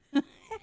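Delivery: high burst of laughter at her own mistake; real laugh. Real, not acted. Laughing at her own wasted tracing. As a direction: laugh out loud at the blunder. Tracing paper flipped mishap.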